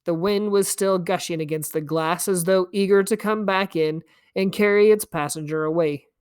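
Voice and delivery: ordinary delivery, nothing marked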